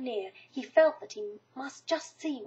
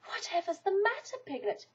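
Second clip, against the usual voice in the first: concerned Christopher Robin; 7 semitones higher than usual. A narrator's reading. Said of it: "said Christopher Robin".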